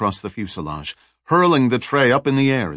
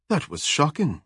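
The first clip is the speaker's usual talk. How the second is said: higher-pitched, lightly amused quip; grinning quip; 6 semitones higher, 3 dB quieter than usual. A narrator's reading. Woman teases Joe after the electrified door handle, grinning lightly. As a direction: toss off the pun with a slight grin.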